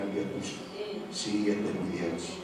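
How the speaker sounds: "El Dios tuyo" breathed in a soft whisper. Shown as a demonstration. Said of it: "Qué lindo que te digan".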